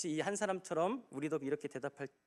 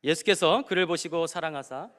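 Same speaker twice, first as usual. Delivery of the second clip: loud scripture reading; 3 semitones higher, 12 dB louder than usual. Shown as a demonstration. Reads the verse aloud.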